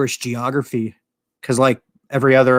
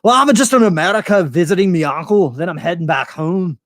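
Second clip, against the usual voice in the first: higher, louder hammed-up Scottish brogue; chatty fake Scot; 6 semitones higher, 5 dB louder than usual. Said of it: "you're sitting there talking, like, Scottish".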